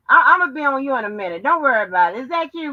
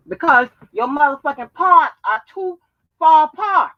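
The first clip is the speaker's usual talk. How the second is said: louder mocking roast punchline; 4 semitones higher, 8 dB louder than usual. Real, not acted. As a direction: land the insult loudly.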